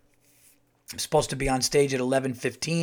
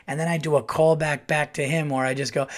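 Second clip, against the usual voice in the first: ordinary talk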